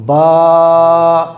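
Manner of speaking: बा held long on a single breath; stretched out in one breath. Shown as a demonstration. Quran teacher demonstrating to students, long drawn-out chant. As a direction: stretch बा out until the breath runs out. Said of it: "पूरे एक सांस में एक लफ्ज़ पढ़ना है".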